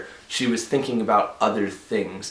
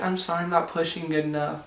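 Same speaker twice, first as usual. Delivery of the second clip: higher, softer meek mimicry of his wife; mock apologetic wife; 8 semitones higher, 5 dB quieter than usual. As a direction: apologize timidly like a sweet patient. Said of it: "She's like".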